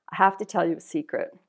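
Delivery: usual speaking voice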